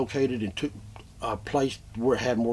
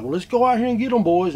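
gung-ho hunter; 8 semitones higher, 5 dB louder than usual. Retold or imitated. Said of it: "would be like".

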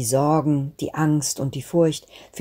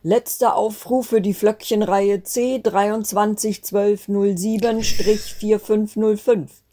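higher last-call announcement, drawn out at the end; Großvater Wetter's announcement; 5 semitones higher, slower than usual. A narrator's reading. Grandfather calls the snowflake children to board, announcing voice. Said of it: "plötzlich Großvater Wetters Stimme hörte".

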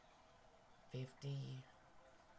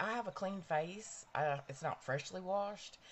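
ordinary talk

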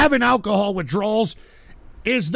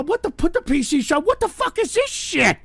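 much higher pitched disbelieving cry; stunned outrage; 7 semitones higher than usual. Real, not acted. Streamer watching a mocking clip, high disbelieving cry.

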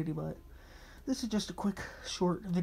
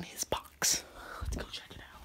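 hushed secret whisper; 4 dB quieter than usual. Real, not acted. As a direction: whisper so he can't overhear. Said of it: "Yes, I'm whispering 'cause he can probably hear".

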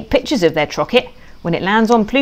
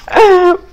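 giggle; 11 semitones higher, 4 dB louder than usual. Acted. Pig laughs at own joke.

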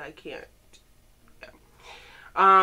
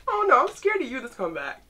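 excited; 5 semitones higher, 6 dB louder than usual. Real, not acted. Scared of the new concept.

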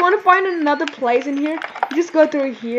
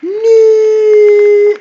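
long, loud, higher-pitched cry; dismayed wail; 4 semitones higher, 9 dB louder than usual. Real, not acted. Stuck in the game, cries out.

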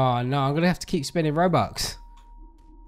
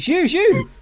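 shooing; 12 semitones higher than usual. Real, not acted. Shooing off a ghost.

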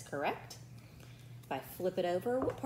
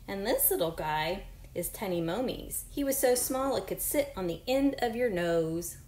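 clear, even read-aloud voice for a class; louder storybook reading voice; reading to children; 6 dB louder than usual. Acted. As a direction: read the book to your pupils. Reads book aloud to class.

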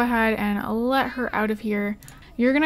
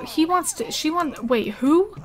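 usual speaking voice

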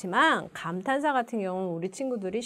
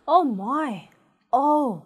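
surprised; 2 semitones higher, much slower than usual. Shown as a demonstration. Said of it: "놀랬을 때 주로 하는".

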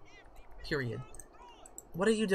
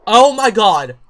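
loud high shocked cry; shocked outburst; 5 semitones higher, 18 dB louder, slower than usual. Real, not acted. Player seeing baby news, loud shocked cry. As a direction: blurt it out in surprise.

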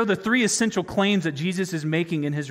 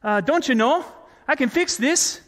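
higher-pitched mock Canadian apology; polite Canadian; 6 semitones higher than usual. Preacher imagines Jesus apologizing to the leaders as a polite Canadian. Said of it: "a polite Canadian and say".

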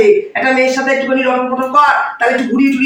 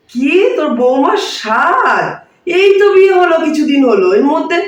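surprised; 6 semitones higher than usual. Acted. Mother on phone, surprised by her friend's news.

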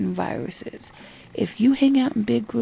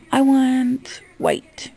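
high and very drawn out, spelling it out; slow demonstration for dim customers; model order; 4 semitones higher, 4 dB louder, much slower than usual. Shown as a demonstration. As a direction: say each word slowly at raised pitch. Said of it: "You need to come up to me and go".

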